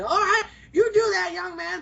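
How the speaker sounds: a hint of a high old lady; lightly voiced granny agreeing. Old customer dismissing teller.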